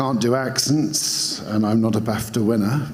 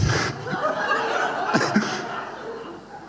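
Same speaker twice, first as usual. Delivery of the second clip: high burst of laughter; 4 dB quieter than usual. Real, not acted. Cracks up at own voice.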